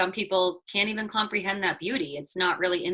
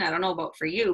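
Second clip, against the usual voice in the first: ordinary talk, nothing marked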